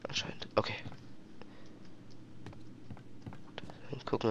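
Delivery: soft, sneaky whisper; hushed so the guard won't hear. Real, not acted. Sneaking past guards quietly.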